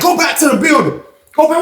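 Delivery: high barked order; mock guard command; imitating guards. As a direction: shout it like the guards did. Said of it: "they like".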